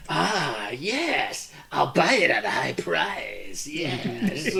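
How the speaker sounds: slow, hushed odd shopkeeper; a hint of a creepy merchant; eccentric merchant. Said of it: "really eccentric, like, hole in the wall kinda guy".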